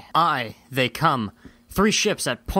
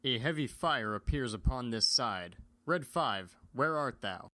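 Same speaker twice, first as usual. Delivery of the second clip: much softer, far-off radio voice; distant radio call; 4 semitones lower, 9 dB quieter than usual. Acted. Pilot searches for Red Five, much softer. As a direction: call out as if over a faint radio.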